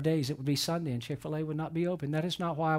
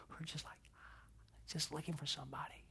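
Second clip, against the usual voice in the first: whispered; 19 dB quieter than usual. Real, not acted. Moved preacher picturing lonely kids.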